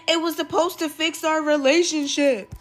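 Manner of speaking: high, loud mock whine; pleading excuse. Leon explaining his plan to Rose, whiny pleading. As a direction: plead your case like a cornered boyfriend.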